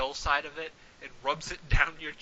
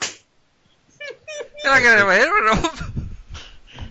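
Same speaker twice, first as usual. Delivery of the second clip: high, loud, drawn out mock plea; hammed-up struggling victim; slow panicky voice; 8 semitones higher, 7 dB louder, much slower than usual. GM playing player's panic.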